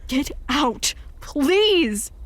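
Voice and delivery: a frightened father begging the visitor to go; desperate shout. Acted. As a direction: beg him to leave at full voice. Dan pleads for Theo to leave.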